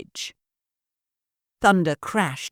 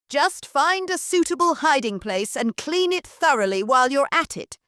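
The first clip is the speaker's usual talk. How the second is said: commanding; 8 semitones higher, 4 dB louder than usual. Acted. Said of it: "Missus Brocket commanded".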